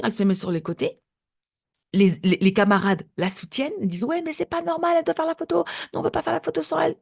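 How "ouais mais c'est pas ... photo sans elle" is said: high-pitched voice of indignant schoolchildren; high mock-protest; protesting classmates. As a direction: imitate kids objecting together, pitched right up. Classmates protest in a retold quote.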